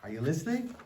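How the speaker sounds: ordinary delivery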